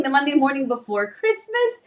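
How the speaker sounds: usual speaking voice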